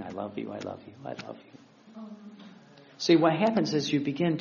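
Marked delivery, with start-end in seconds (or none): 0.0-1.6 very low, soft repetition, speeding up; unstoppable endearment. Retold or imitated. Jesus telling Louisa of love he couldn't stop, hushed murmur. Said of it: "I could not stop saying".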